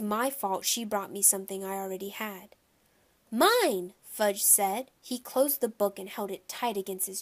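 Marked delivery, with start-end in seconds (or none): 3.3-3.9 put-on loud, higher little-kid claim; possessive toddler. A narrator's reading. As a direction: cry it out like a grabby small child.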